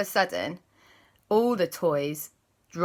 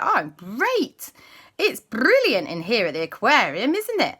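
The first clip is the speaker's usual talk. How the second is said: higher, cheery puppy voice; Sky's voice; 5 semitones higher, 5 dB louder than usual. Acted. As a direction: chirp it brightly like a small dog. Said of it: "And she said".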